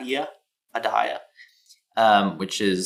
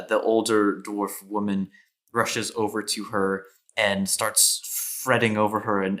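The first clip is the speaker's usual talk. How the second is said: normal talking voice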